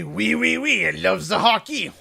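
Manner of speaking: high, loud hammed-up French-Canadian accent; mock French Canadian. Mocking Canadians on a TV comedy show, loud put-on French-Canadian accent. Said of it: "he would come on and say".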